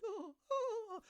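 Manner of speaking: hammed-up high, loud groaning cries; loud, high groans. Man on the toilet, overheard in the faculty bathroom, hammed-up high, loud groaning cries. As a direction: wail it like a Dumb and Dumber scene. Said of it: "Dumb and Dumber level".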